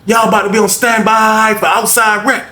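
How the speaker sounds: hoog en luid geschreeuwd; guard shouting. Retold or imitated. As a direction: schreeuw het door de hele afdeling. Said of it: "Lady said".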